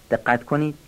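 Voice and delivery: ordinary delivery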